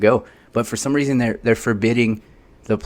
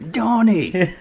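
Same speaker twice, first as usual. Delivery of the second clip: Nigel Thornberry call; 12 semitones higher than usual. Pretend. Nigel Thornberry bit, calling.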